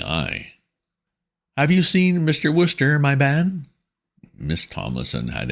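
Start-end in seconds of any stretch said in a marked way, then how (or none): 1.6-3.7 high, prim schoolmistress tone; stern headmistress voice. A narrator's reading. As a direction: speak down to him like a severe headmistress. Miss Tomlinson voiced.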